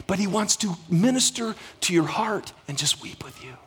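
whispered, soft and intimate; tender whisper. Real, not acted. Preacher whispering God's compassion to the congregation, tender. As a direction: whisper it like comfort to someone grieving. Tender close to a sermon point.

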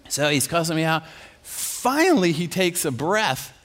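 usual speaking voice

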